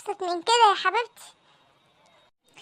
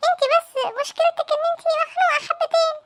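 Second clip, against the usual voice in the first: high coaxing; 6 semitones higher than usual. Acted. Husband soothing upset wife.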